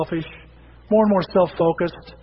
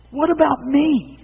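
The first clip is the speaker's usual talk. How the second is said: the same complaint pitched higher and slower; higher whiny repeat; 8 semitones higher, slower than usual. Pretend. Preacher escalating his mock of self-focus, higher whine. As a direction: push the whine further up, like a pleading child. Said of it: "society is bombarding us with".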